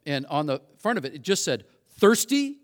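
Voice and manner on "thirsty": punchy ad headline. Retold or imitated. Pamphlet headline read out.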